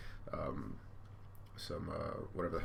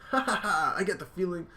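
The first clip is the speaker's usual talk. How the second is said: much higher, louder cartoon voice with a laugh; cheer, then laugh it off; high hammed-up relief; relieved hero; 12 semitones higher, 4 dB louder than usual. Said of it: "And he's like".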